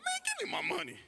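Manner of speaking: high, exasperated blurt; high mock exasperation. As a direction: snap back at the teller, pitch shooting up.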